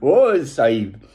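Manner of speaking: a light, playful put-on Indian accent; a jokey hint of an Indian accent on oh dear. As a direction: slip into a lightly voiced Indian accent, slowing on Sahib. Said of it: "I'm not allowed to go into my Indian accent".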